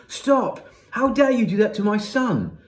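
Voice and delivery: high, loud indignant mother; outraged shout. A narrator's reading. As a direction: burst in to defend your son.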